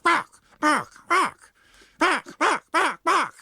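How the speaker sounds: high, sharp little dog barks; yapping dogs. Acted. Pugs and Poms wanting to join the fray, yapping high barks. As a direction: yap like a tiny angry dog.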